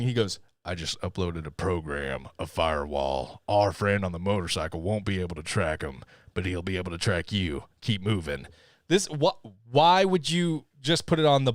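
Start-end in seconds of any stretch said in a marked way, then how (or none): 0.6-8.5 a hint of a low, softer mysterious man; lightly hushed low voice. Retelling the man's warning to the boy, low hint. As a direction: keep it low and secretive. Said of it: "so he whispers and everything".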